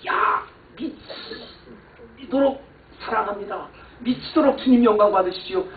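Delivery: a mock madman's fervent praise; hammed-up frantic worship; acting like a madman. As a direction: play it like someone gone crazy with love. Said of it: "미친 사람을 했더니".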